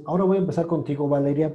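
ordinary talk

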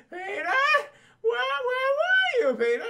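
put-on high, slow worried old aunt; Aunt May voice. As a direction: stretch it out like a fretful old aunt.

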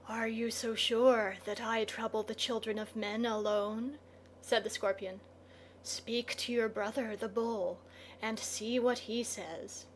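soft scorpion voice, coolly dismissive; hushed, understated menace; sinister scorpion, unhurried. A narrator's reading. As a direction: answer quietly as a creature in darkness.

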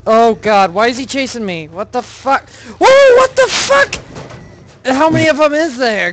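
high, loud panicked yelps; panicking gamer. Real, not acted. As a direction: freak out as it chases you. Chased by monsters in game.

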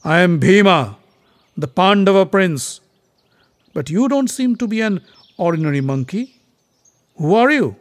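bold prince. Acted. Bhima demands the monkey's name.

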